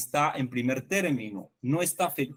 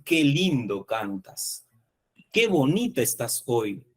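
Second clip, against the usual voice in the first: "Qué" drawn out and stressed, slow. Shown as a demonstration. Teacher contrasting an emphatic exclamation, slow stress on qué. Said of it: "Es distinto a decir".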